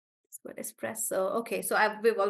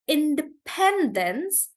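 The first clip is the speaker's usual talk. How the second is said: independence slowly, PEN raised; stress on PEN; 4 semitones higher, slower than usual. Shown as a demonstration. Teacher taking students' examples, independence stressed on pen. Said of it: "the stress is on pen".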